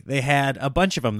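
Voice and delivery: usual speaking voice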